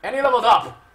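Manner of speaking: loud, high victorious shout; triumphant cheer. Real, not acted. Player celebrating a level-up, triumphant cheer.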